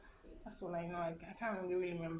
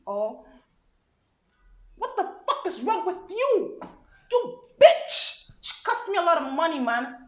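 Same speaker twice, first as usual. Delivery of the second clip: furious American accent, shouted much higher and louder; American accent rant; 10 semitones higher, 13 dB louder than usual. Shown as a demonstration. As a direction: yell it like a Nigerian back from America, enraged. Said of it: "he's American, you know, he just come back".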